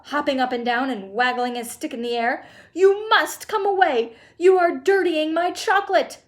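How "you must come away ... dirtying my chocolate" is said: high, loud, slowed protest; crying out. A narrator's reading. Chocolate maker ordering boy away from chocolate, crying out. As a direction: insist urgently at full voice. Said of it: "cried Mister Wonka".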